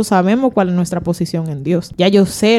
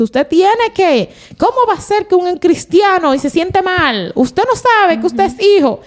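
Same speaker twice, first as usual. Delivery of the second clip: mock pulpit exhortation, pitched way up; preaching pastor; 10 semitones higher than usual. Pastor urging believers from the altar, mock preaching high. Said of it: "el pastor, que desde el altar".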